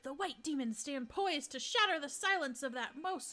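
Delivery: theatrical, swooping prophet of doom; melodramatic bird character. Acted. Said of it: "get a real good voice for this bird".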